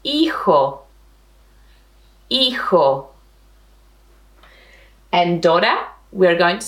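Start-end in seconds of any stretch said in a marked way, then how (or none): 0.0-0.8 "hijo" starting straight on the ee vowel; silent H. Shown as a demonstration. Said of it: "the H is silent in Spanish". 2.3-3.1 "Hijo" starting straight on the ee vowel; silent H. Shown as a demonstration. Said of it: "the H is silent in Spanish".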